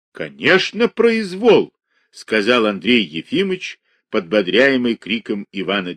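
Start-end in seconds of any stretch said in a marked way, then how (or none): 0.1-1.7 higher, louder, fired-up agreement; taking up the shout, indignant; emboldened agreement. A narrator's reading. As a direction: catch his anger and echo it. Andrei joins Ivan's shouting.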